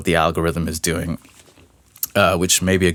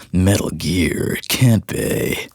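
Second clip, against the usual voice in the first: Solid Snake impression, slow and rough; slow, rough soldier voice impression; much slower than usual. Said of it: "the best Snake voice I've ever had".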